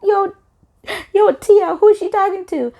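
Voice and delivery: very high, incredulous squeal; indignant disbelief. Retold or imitated. Asking a friend about the insult, high-pitched incredulous re-enactment. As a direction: go way up high, acting out affronted disbelief.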